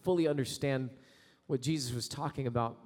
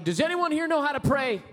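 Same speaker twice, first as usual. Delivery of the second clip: high, loud call across the deck; a captain shouting over a storm; captain's shout; 11 semitones higher, 6 dB louder than usual. Retold or imitated. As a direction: call out to the whole crew. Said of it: "he shouted".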